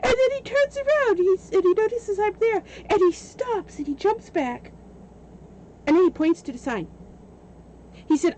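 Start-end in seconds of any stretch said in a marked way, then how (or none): 0.0-6.9 high, loud, rushing storytelling; excited retelling. Real, not acted. Telling friends about the trespasser, excited and loud. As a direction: tell it like it just happened.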